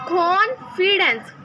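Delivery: कॉन्फिडेंस drawn out very slowly, kh stressed; slow model word. Shown as a demonstration. Teacher drilling the word कॉन्फिडेंस slowly. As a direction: stretch out कॉन्फिडेंस syllable by syllable. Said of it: "कॉन्फिडेंस means कॉन्फिडेंस".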